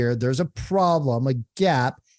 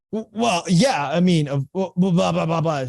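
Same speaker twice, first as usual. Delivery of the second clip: higher, stammering mock upset; imitating upset homeowner; 5 semitones higher, 3 dB louder than usual. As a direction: play her flustered and annoyed. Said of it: "She was upset".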